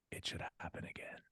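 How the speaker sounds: voiceless whisper, dark and matter-of-fact; eerie whisper. Retold or imitated. Dad retells his daughter wishing extinction again, whispered. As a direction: breathe it out like a creepy child.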